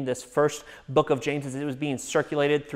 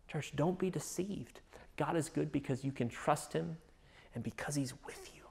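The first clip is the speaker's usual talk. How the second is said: hushed refrain; 11 dB quieter than usual. Real, not acted. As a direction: lean in and say it softly. Quiet emphatic refrain to congregation.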